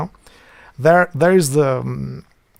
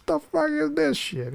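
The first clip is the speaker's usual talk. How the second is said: high, soft mock disgust; Italian exasperation; 13 semitones higher, 5 dB quieter than usual. Shown as a demonstration. Showing how Italians react, high and soft. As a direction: sneer at it like an offended Italian. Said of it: "They used to do like this".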